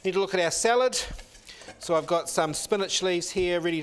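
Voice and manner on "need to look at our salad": ordinary talk, nothing marked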